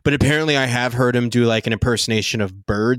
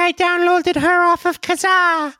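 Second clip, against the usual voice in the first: squeaky little computer virus; cute germ voice. Pretend. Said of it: "this is Bjork".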